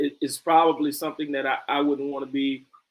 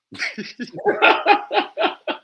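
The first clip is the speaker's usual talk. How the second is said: high burst of laughter; 7 dB louder than usual. Real, not acted. Cracks up at the girl voice.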